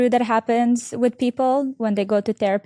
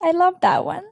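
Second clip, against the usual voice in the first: much higher, bright-eyed delight; 10 semitones higher than usual. Real, not acted. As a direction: gush over the quote. Host delighted by a quote.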